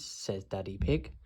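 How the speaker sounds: plain reading voice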